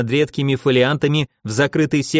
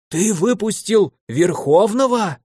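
slow, high, incredulous question; old mage aghast, stretching each word; horrified disbelief; 7 semitones higher than usual. A narrator's reading. Old mage horrified at demon's release. (потрясённо, не веря ушам)

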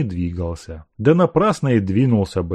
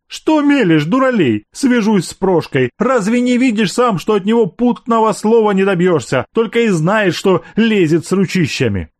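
a lightly voiced angry woman, higher and louder; scolding housekeeper; 8 semitones higher, 4 dB louder than usual. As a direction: scold him like a riled-up housekeeper.

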